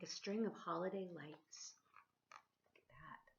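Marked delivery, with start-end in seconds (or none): 2.7-3.3 hushed, much softer whisper. Real, not acted. Reader whispering to the child over the picture. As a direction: breathe it like a secret.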